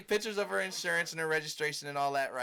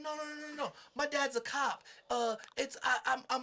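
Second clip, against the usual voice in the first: much higher, stammering fake panic; mock flustered woman; 8 semitones higher than usual. To him, the driver panics and claims her dad's a cop, high stammer. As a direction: babble nervously up high.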